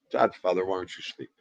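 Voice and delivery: ordinary delivery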